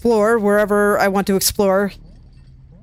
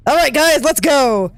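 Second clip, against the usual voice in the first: playful raised call to the troops; rallying call; 5 semitones higher, 3 dB louder, slower than usual. Pretend. Gamer rallying the creatures to follow, playful rallying call. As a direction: call them to move, a bit higher.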